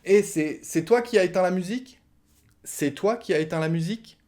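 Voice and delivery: "C'est toi qui as éteint la musique?" high and rising; rising question tune. Shown as a demonstration. Asking from the shower who cut the music, rising. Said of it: "on monte l'intonation à la fin".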